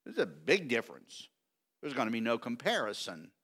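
usual speaking voice

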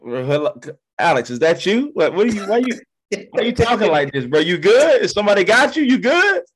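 incredulous, checking if his cousin is okay; baffled disbelief. Retold or imitated. To his cousin on the phone, climbing disbelief. Retold reaction to cousin's odd call.

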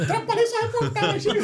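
voz aguda e risonha, imitando a amiga; mock teasing friend. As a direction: zombando do repórter com voz bem alta. Imitating Lúcia teasing him. (imitação brincalhona, aguda e rindo)

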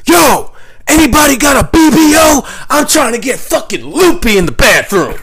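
very high, loud hammed-up holler; raucous high-pitched calling out; acted hollering. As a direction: yell it down a whole cell block. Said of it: "walk around literally four in the morning and go".

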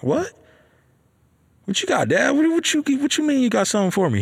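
high-pitched, stumbling incredulous questions; acted surprise. Son asking dad about an unexpected gift, surprised. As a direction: voice leaps up, tripping over the words.

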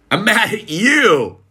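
high, drawn-out mock outburst; hammed-up kid's angry accusation; imitated angry child.

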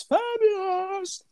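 high, slow, flamboyant compliment; fabulous voice. Pretend. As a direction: purr it like a diva. Mock quote, high and slow.

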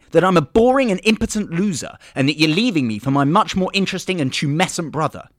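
loud, high, frantic demands; loud customer. Acted. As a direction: shout out the list.

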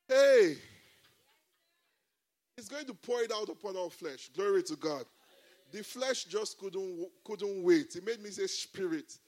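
high, loud, fervent pulpit delivery; rousing sermon voice, building to shouts of praise; preaching at full force. Acted. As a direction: preach it out to the whole church. Pastor preaching to congregation.

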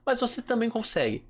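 ordinary talk

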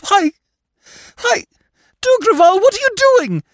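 very high, loud cry of horror; panicked shriek. A narrator's reading. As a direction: shriek in sudden alarm at her husband. Wife sees husband with revolver.

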